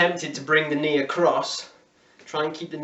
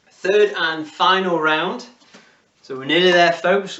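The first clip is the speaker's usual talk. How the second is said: louder, slower rallying call; loud announcement; 4 semitones higher, 8 dB louder than usual. Real, not acted. Coach announcing the last round to the class, loud and upbeat. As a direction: pump them up for the final round.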